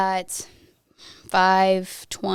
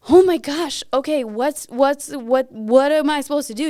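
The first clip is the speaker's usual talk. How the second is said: raised, louder shocked exclamation into worried questions; retold alarm; 5 semitones higher, 4 dB louder than usual. Wife retelling the call about Blake, alarmed and raised. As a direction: re-enact a flustered reaction to bad news. Retelling her panic at the news.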